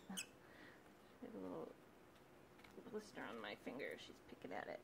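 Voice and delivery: low soft aside about the bird pecking. Real, not acted. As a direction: drop the voice for a quick aside.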